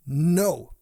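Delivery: loud, curt refusal; sharp snap. A narrator's reading. Fairy queen cutting off the pirates, loud angry snap. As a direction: cut through the crowd's noise. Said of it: "Diana snapped".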